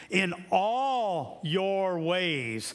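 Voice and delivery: "all" drawn out long and high; stress on all. Shown as a demonstration. Preacher corrects those wanting guidance in some areas, "all" stretched emphatically. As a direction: stretch "all" slowly, pitch lifted.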